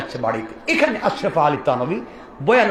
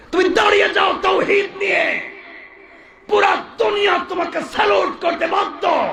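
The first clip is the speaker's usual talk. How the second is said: sustained high preacher's proclamation; rousing shout. Acted. Preacher urging the youth to stand with tawhid, high sustained shout. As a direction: cry it out high, held on one ringing pitch.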